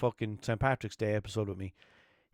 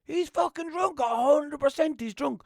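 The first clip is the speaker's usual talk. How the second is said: high, loud, slow claim; mocking his mate's version; 6 dB louder than usual. Mate's memory of his verdict, mock high exclaiming. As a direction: ham up the drunk accusation. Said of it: "he says that I said".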